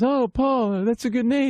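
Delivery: high impersonated voice. Retold or imitated. Star greets his namesake fan, high impersonated voice. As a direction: lift it high, drawing out the opening. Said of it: "He goes".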